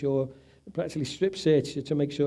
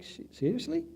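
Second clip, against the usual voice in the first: incredulous; 9 semitones higher, 7 dB quieter than usual. Retold or imitated. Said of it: "I'm like".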